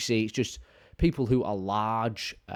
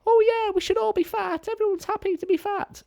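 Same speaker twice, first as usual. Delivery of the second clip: very high, louder falsetto mockery; mocking fat-pride voice; 5 dB louder than usual. Mocking fat-positive communities in a high falsetto. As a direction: squeak it out to mock the cheerleaders. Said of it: "that suddenly are like".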